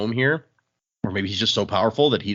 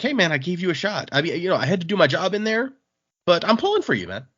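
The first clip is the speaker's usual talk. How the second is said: friendly; 7 semitones higher than usual. Retold or imitated. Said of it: "now she's trying to be friendly".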